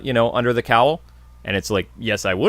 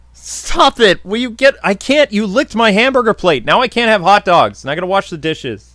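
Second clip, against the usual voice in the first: scolding the cat; 7 semitones higher, 4 dB louder than usual. Real, not acted. Scolding cat that licked plate.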